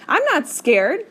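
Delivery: high, loud insistence that wobbles; shaky denial. A narrator's reading. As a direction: insist you're fine while the voice shakes. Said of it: "my voice is shaking".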